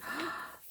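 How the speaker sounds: high, soft intake of breath; marvelling gasp. Real, not acted. Crafter sees embossed flowers in the scrapbook, marvelling gasp. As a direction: gasp softly at a lovely surprise.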